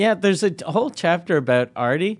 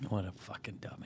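breathy whisper, far softer; whispered aside; 9 semitones lower, 21 dB quieter than usual. Real, not acted. Cohost reacting to the story, whispering a quick aside. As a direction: toss it off under your breath.

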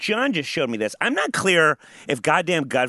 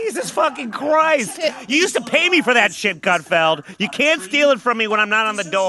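high, loud outburst of outrage; worked-up, raised-pitch protest; indignant rant; 8 semitones higher, 4 dB louder than usual. Real, not acted. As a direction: rail at the one who stole from you. Indignant rant about stolen segment.

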